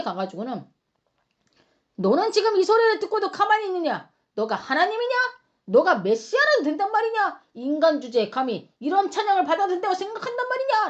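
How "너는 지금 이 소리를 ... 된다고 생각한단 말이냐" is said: high-pitched indignant interrogation; confrontational challenge. Acted. Chief priests confronting Jesus, indignant high challenge. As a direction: fire accusing questions in affront. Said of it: "라고 막 따지고 난리가 난 거예요".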